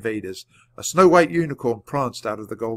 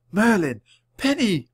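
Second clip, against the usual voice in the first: high, delighted recognition; each name lifted high, then falling; breathed exclamation; 9 semitones higher, 4 dB louder than usual. A narrator's reading. Annie sees Merlin and Penny, breathed amazement. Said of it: "breathed Annie".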